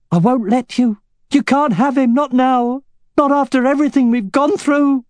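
high, loud desperate pleading; desperate wife. A narrator's reading. Katrina pleads against the duel.

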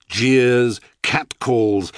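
ordinary delivery

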